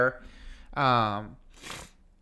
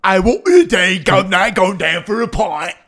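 hammed-up rough British accent, much higher and louder; mock rough British accent; 7 semitones higher, 11 dB louder than usual. Co-host lampooning a Twi'lek character in a rough British accent. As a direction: send someone off to the pub in a rough British accent. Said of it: "That's like the redneck accent of British accents".

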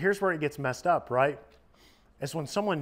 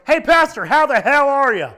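booming, much higher and louder hearty hello; biker's loud greeting; 11 semitones higher, 13 dB louder than usual. Retold or imitated. New convert biker greets the pastor in the lobby, booming. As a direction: belt it across the lobby like a big tatted biker. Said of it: "The guy goes".

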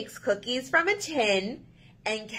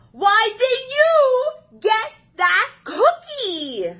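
loud, whiny pigeon pleading its case; a needy bird bursting with complaints; excitable pushy pigeon; 10 semitones higher, 4 dB louder than usual. Acted. Pigeon pestering the Duckling about the cookie, whiny and loud.

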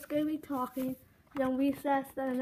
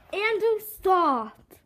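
stern warning; 4 semitones higher, 6 dB louder, much slower than usual. Acted. Teacher scolding student.

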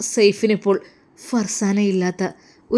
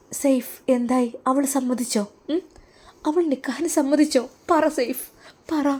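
higher, insistent wife's voice; eager pressing wife; 5 semitones higher than usual. Acted. Wife pressing Saif about the marriage, higher and insistent. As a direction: tug at him for an answer.

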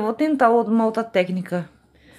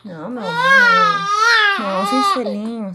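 much higher, louder soothing coo; 10 semitones higher, 5 dB louder than usual. Real, not acted. Mother stopping her baby, high cooing baby talk.

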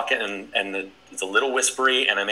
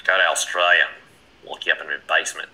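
put-on lower, botched Australian accent; terrible Australian accent; 4 semitones lower, faster than usual. Host echoing the comment in a terrible Australian accent. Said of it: "That's a terrible Australian accent".

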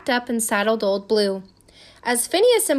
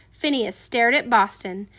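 ordinary delivery, nothing marked

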